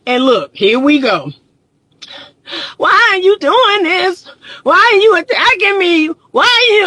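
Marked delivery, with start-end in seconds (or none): 2.8-6.9 high, overwrought imitation of an accusing wife; mock hysterical wife. Wife interrupting husband's script in counseling, mock hysterical. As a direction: wail it in mock outrage, pitched way up. Wife's outburst acted in counseling story.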